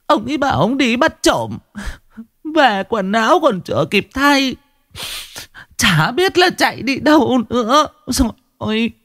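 high, weeping lament; wailing sobs. Acted. Mrs. Khánh sobs to the villagers about her missing husband. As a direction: cry it out to the neighbours. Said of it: "khóc bù lu bù loa".

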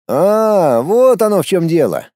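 exaggerated understanding; knowing realisation. A narrator's reading. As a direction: let it dawn on you, knowingly. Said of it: "понятливо закивал головой Эдмунд".

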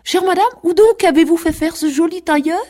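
high, mincing society lady; affected lady visitor. A narrator's reading. Little girl playing ladies questions her sister, affected and high. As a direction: put on a loud, precious grand-lady voice. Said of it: "en pinçant la bouche".

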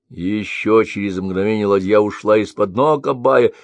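usual speaking voice

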